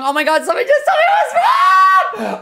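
high laughing shout of disbelief; shocked laughing shriek. Real, not acted. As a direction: shriek it through a laugh, stunned. Just learned she guessed wrong.

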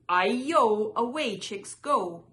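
loud, taunting storybook cry. A narrator's reading. Runaway rice cake escapes pecking chicks, crying out. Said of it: "It cried".

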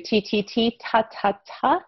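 soft tapped T sounds; sounding out T. Shown as a demonstration. Teacher modelling the T sound for a child. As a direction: tap each T lightly, then ta. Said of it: "the letter T stands for t".

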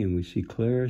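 ordinary delivery, nothing marked

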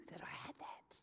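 hushed, voiceless, unhurried; awed whisper. Retold or imitated. Preacher retelling the missionary's awe, whispered. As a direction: breathe it like a secret. Awed confession of receiving mantle.

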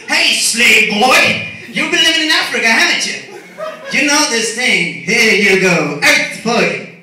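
loud, drawn-out mock call to a boy, then fast and loud. Mocking the cartoon hero handing out powers, loud condescending call.

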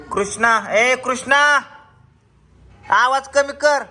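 loud high call across the house. Real, not acted. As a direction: shout to the next room to turn it down. Shouting to son across house.

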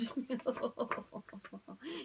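high, silly villain titter; gleeful prince giggle. Acted. Prince John laughs.

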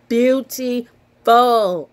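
beauty Full stretched slow and loud, both halves hit; gushing beauty Full, pulled long and loud; drawn-out praise. Real, not acted. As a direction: lean hard on beauty Full, slowly. Gushing over the baby photo.

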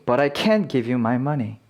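full-vowel CAN'T as the peak; stressed can't. Shown as a demonstration. Teacher repeating negative half for learners, stressed can't. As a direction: put the weight on 'can't'. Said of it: "강세가 있으면 부정일 때가 많다".

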